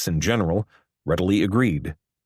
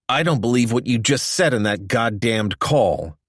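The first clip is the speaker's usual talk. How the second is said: raised, angry protest; storming; 3 semitones higher than usual. A narrator's reading. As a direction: let him storm at the caller. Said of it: "Horrigan stormed".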